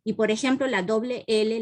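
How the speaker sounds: ordinary delivery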